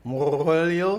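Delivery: imitating his accented Moyo, rising; mock accent. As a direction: echo the accented name back as a question. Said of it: "have these accents you be doing".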